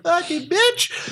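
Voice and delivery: high, slow jeer; drawn-out squeal of mock scorn; mock insult. Retold insult after she walked away.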